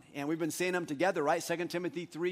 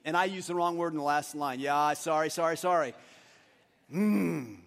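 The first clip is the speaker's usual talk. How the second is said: normal talking voice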